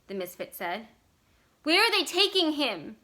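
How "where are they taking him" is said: high, loud, panicked question; mother screaming for her husband; frightened scream. A narrator's reading. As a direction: cry out in fear. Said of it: "the children's mother screamed".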